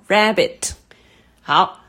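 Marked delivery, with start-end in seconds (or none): 0.1-0.7 rabbit, RAB higher than bit; stress on first syllable. Shown as a demonstration. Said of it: "它的重音也是在第一音节".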